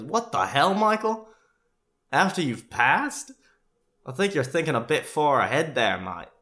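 baffled Aussie dad, drawing it out; Australian dad in disbelief. Retold or imitated. Dad questioning Michael's dark plans, incredulous Australian accent. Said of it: "He literally says that".

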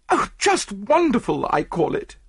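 high, gushing, smiling woman; Mrs Opalsen. A narrator's reading. Client's wife praises the detective, high and gushing. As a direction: gush with delight, pitch right up. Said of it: "said Missus Oppleson, smiling all over".

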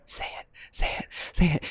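breathless whisper, willing her on; urging whisper. Retold or imitated. Crowd member urging the trustee in a whisper. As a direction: hush it like the crowd holding its breath.

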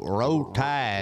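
lazy Southern row tide; Southern accent. Pretend. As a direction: drawl it like an Alabama fan. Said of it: "you gotta use a Southern accent".

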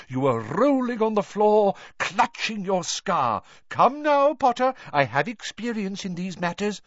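high, insistent, theatrical voice; excited professor. A narrator's reading. Professor coaxing a pupil to confess, excited and high. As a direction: insist high and emphatic, stretching the key words.

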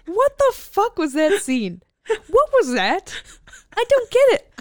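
高声崩溃的质问; shrieking disbelief, almost crying; explosive rage. Real, not acted. Baffled outburst at movie scene.

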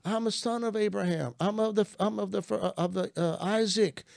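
high-pitched, insistent claim; retold pleading. Man arguing to God as Abraham's son, high insistent plea. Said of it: "I start indicting God".

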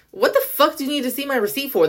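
high-pitched indignant retort. Retold or imitated. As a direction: push it up high, affronted at being asked. Retold indignant reply to receipt checker.

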